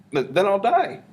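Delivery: high, loud mock panic; mock alarm. Teacher answering the poem's poison question, mock alarm.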